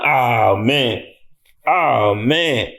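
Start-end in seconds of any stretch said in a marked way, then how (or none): none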